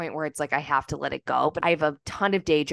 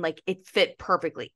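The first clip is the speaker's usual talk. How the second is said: normal talking voice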